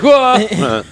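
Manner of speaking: high-pitched startled "whoa"; startled yelp. Acted. Game master voices a prince startled by someone appearing, startled yelp. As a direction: blurt it out in mock shock. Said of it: "otherwise he'd be like".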